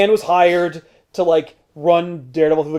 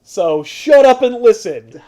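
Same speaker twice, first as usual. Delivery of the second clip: villain voice; 7 semitones higher, 6 dB louder than usual. Retold or imitated. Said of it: "So he's like".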